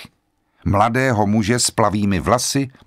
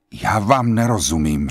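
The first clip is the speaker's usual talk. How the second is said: plain reading voice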